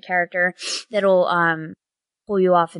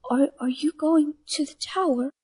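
a touch higher, timid mother bird; lightly voiced bird mother; 5 semitones higher than usual. Bird mother timidly asks the party a question. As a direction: ask hesitantly, as a worried mother.

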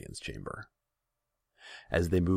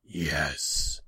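growling feline demon; rumbling growl; 4 dB louder than usual. A narrator's reading. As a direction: answer as a rumbling cat demon. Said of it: "His voice was a rumbling growl".

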